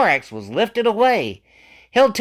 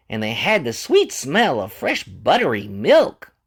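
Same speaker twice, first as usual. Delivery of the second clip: ordinary delivery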